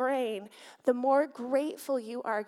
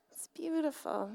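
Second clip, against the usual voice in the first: "beautiful" said softer, lingering and fond; soft tender echo; 4 semitones higher, 5 dB quieter than usual. Acted. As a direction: repeat it to yourself, hushed. Admiring the Bible again.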